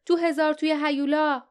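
narrator's contained yell; mild acted shout. A narrator's reading. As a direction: cry it out, pitch jumping up. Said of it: "لیف فریاد زد".

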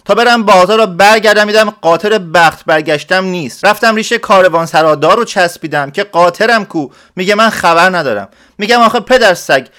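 high and loud, telling his woe; agitated peasant shouting. Acted. Villager shouts the tale of his stolen mule, high and loud. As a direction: pour out the story in a loud rush. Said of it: "داد کشید".

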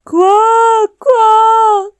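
huge, high, drawn-out quacks; overjoyed bear yelling like a duck; bear's shouted quack. A narrator's reading. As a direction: bellow the quacks with all your might. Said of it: "با صدای خرسیش بلند فریاد زد".

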